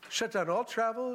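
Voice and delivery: ordinary talk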